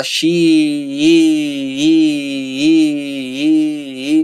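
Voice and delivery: stretched i on one note; level pitch. Shown as a demonstration. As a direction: hold every i at the same pitch, slowly. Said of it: "na mesma altura".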